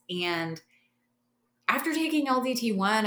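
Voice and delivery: usual speaking voice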